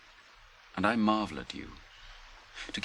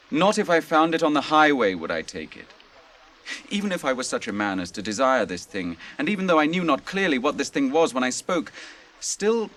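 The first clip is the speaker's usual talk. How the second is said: louder, higher and firm; fierce vow; 6 semitones higher, 6 dB louder than usual. Acted. Faramir to the hobbits, proud of his word, fierce vow. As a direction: declare it like an oath.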